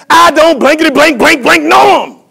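high, loud mock-swearing shout; acted outburst of blustering denial; hammed-up cussing. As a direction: holler the bleeped curses in comic fury. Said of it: "Peter began to cuss".

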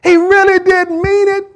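high, loud insistence building to a strained cry; impassioned preaching. Real, not acted. Preacher defending Peter's sincerity to congregation, impassioned and loud. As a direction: plead his case at rising full force.

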